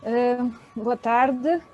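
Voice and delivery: ordinary delivery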